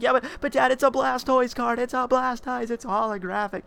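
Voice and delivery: high-pitched imitation of a begging child; pleading kid.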